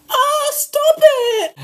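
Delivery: high, loud mock scared squeal. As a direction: squeal like a spooked friend. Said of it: "And she was like".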